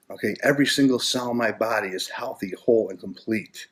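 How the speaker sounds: normal talking voice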